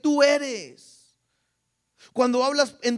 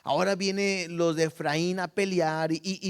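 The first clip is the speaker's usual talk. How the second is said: ordinary talk, nothing marked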